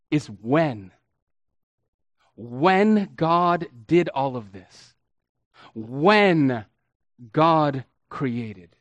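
when said slow and heavy; stress on when. Shown as a demonstration. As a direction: linger on the word when. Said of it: "what they want us to zero in on".

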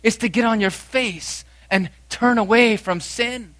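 loud, high, pressing sermon voice; a preacher crying out to turn from sin; full-force preaching. Acted. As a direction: urge them to repent at full force. Preacher exhorting congregation.

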